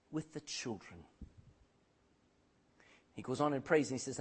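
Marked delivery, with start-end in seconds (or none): 0.1-1.1 soft prayer. Acted. Preacher voicing Jacob praying for his family, softly. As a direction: drop low and soft at the end.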